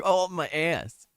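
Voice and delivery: ordinary talk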